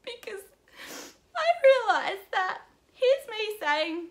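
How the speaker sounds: high, tearful voice breaking out; real crying. Real, not acted. Vlogger confessing what broke her heart, crying. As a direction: speak up through real tears. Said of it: "I'm just gonna cry".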